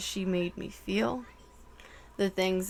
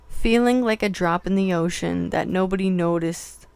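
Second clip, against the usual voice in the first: lyric reading; 7 dB louder than usual. Acted. Reads song lyrics aloud.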